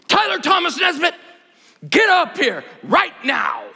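stern father shouting a son's full name; dad angry voice. Retold or imitated. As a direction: yell for the kid like he's in trouble. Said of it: "use that dad angry voice".

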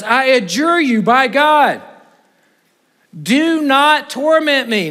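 preached demon plea, stretched out; demons pleading. Acted. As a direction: beg slowly as the cornered demons. Demons pleading before Jesus.

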